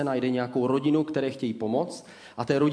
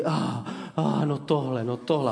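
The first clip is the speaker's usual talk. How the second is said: mock amazed sighing. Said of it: "všichni vzdychají a říkají".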